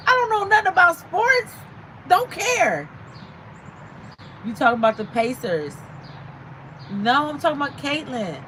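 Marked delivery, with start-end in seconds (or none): 0.1-2.9 higher, louder exclamation; high exclaiming. Real, not acted. Streamer to viewers, confessing loudly she knows no sports. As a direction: throw up your hands, voice up high.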